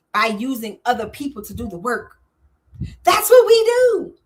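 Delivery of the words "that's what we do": loud, slow, high proud declaration; proud punchline. Real, not acted. Host proudly tells viewers this is their job, loud and slow. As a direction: land it big and slow.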